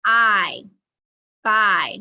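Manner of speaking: long "I" stretched, then "buy" blended; sounding out. Shown as a demonstration. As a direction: glide from "I" into "buy".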